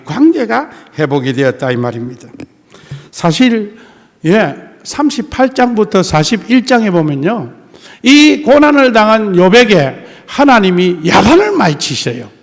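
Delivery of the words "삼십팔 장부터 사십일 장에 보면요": usual speaking voice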